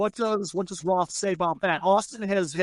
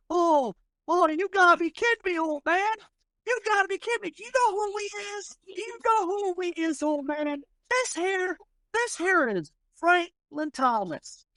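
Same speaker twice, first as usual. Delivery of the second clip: taunting gunslinger; 11 semitones higher than usual. Acted. Gunslinger's crony mocking the old man, high and jeering. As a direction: needle the old man with a sneer.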